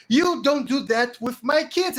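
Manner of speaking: loud, high furious warning; acted enraged yell; angry confrontation. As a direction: shout it like an outraged protector. Acted man attacking another over kids.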